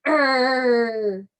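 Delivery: "err" as one long, growly vowel; phonics sound. Shown as a demonstration. Teacher showing what I and R say, drawn-out "err". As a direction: hold "err" out like a bear's growl. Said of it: "makes this sound".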